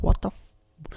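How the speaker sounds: high, cut-off exclamation; mock shock. Podcaster voices the ant thrown onto dirt, mock shock. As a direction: start an alarmed curse and break off.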